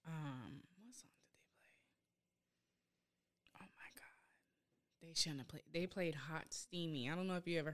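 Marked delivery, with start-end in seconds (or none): 0.8-5.0 shocked breathless whisper. Real, not acted. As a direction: whisper it in disbelief.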